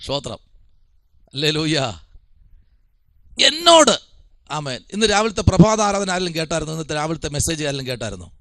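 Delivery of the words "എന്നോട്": എന്നോട് high and loud, falling at the end; stress on എന്നോട്. Retold or imitated. Preacher driving home whom to ask, emphatic high എന്നോട്. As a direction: punch out എന്നോട് alone, high-pitched. Repeats the key word for emphasis.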